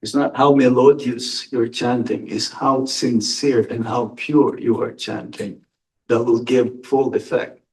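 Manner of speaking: usual speaking voice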